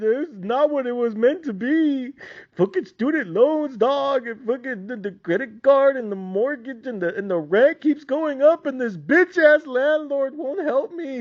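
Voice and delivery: high whining sob-voice complaint; whiny crying plea. Retold or imitated. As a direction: whimper it out like a broke grad. Whiny graduate pleading to General.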